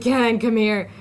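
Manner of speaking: loud call to a friend. Retold or imitated. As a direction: call him over. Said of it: "I was like".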